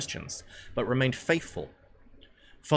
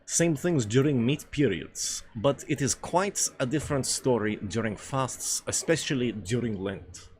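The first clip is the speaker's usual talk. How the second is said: put-on hammy Russian accent, deliberate pace; Russian character; 3 semitones higher than usual. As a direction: play the hearty Russian dinner companion.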